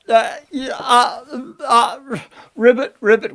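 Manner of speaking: grand doorman's call breaking into stammering; pompous servant. Acted. Servant finds a frog at the door, pompous servant flustered. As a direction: call out formally, then splutter into croaks.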